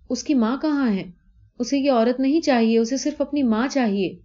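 put-on a worried child wanting her mother; scared little girl. Girl Salu wondering where her mother is, lightly voiced child. Child's frightened inner thoughts.

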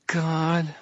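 put-on high, light young man's voice; light young tenor. A narrator's reading. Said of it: "a light tenor of a young man".

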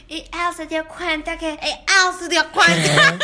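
hammed-up smoker's dubbing voice; smoker-lungs imitation. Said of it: "como de fumador de cincuenta".

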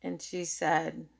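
ordinary delivery

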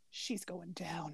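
slow breathy threat; devilish whisper. Retold or imitated. Quoting the shoulder devil, a slow menacing whisper. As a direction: whisper it like a scheming little devil.

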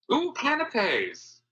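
high, slow, delighted "ooh"; mock delight. Magnate spotting canapes at the party, high and delighted. Silver persona spotting food.

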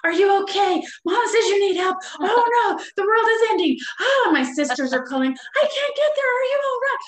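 high, loud, frantic fatherly alarm; panicking dad. Retold or imitated. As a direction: ham up a father who thinks the world is ending. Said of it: "my dad's calling".

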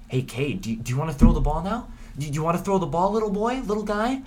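put-on high, condescending baby-talk to a player; mocking coach voice. Coach teasing his quarterback in a high sing-song voice. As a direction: coax him like a little kid, way up high. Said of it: "It could be Josh Gattis saying".

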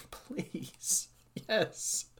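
breathless whispered please. Real, not acted. Fan hoping for a faction, whispered plea. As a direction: beg under your breath.

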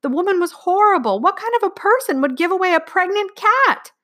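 put-on high, wailing child's protest; sobbing plea. A narrator's reading. Girl begs dad to keep the cat, sobbing. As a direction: wail and beg, pitched right up. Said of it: "Martha sobbed hard".

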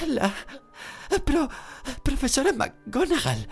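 halting, high, panicked gasps; faltering voice. A narrator's reading. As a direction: stumble over words in shock. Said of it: "con voz entrecortada".

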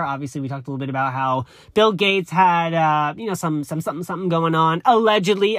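ordinary delivery

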